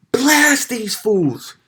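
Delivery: high, loud, drawn-out yell; mock shouted order. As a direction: imitate a hyped-up guy egging on the gunman. Hyped little guy orders gunman to shoot.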